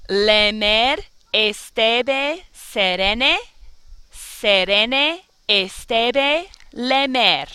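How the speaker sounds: "Le mer estebe serene" with every vowel a Spanish e, very slow; all vowels as e. Shown as a demonstration. Teacher models the e version of the rhyme, very slow. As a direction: stretch "estebe" slowly, each vowel a clean eh. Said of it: "So this is how this will sound".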